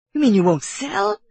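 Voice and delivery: high-pitched, incredulous question; gasped disbelief. A narrator's reading. Kiawe learning the bosun will keep the bottle, high aghast question. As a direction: ask it aghast. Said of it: "gasped Kiawe".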